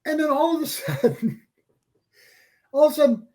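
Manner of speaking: usual speaking voice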